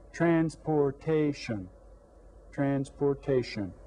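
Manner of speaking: slow, syllables stretched out. Shown as a demonstration. Teacher sounding out a word for the class, syllable by syllable. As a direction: say "Transportation" slowly, one syllable at a time. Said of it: "Let's try".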